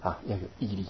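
hushed comforting words; calm her quietly; soft reassurance. Retold or imitated. Monk soothing feverish old lady, hushed. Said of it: "说".

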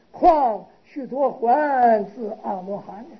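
slow, measured recitation of a sutra; scripture recitation. Acted. Monk reading the precept text aloud to the assembly, slow and intoned. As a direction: intone each phrase of the canon slowly.

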